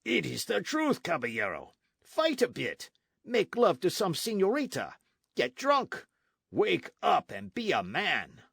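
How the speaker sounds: hearty soldier. Acted. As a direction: rouse a lazy friend to fight.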